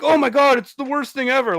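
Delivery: high, mock whiny complaint; whiny complainer. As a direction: whine like it's a tragedy. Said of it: "and you're like".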